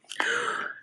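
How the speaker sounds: high sharp mock gasp; dramatic gasp. Storyteller gasps for children as the vegetables run out, high mock gasp. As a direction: gasp in pretend shock. Countdown reaches nothing.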